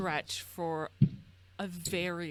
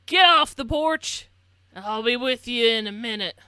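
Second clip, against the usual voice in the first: gruff shout; 4 semitones higher, 11 dB louder than usual. Acted. Old man telling the kids to get off his porch, loud and gruff. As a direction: order them off the porch.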